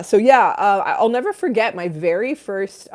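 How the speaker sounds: ordinary delivery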